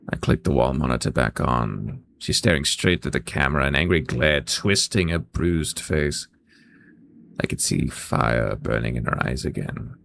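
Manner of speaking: low-pitched, subdued narrator; story narration. Acted. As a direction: read the scene in a low, even register. Reads game narration.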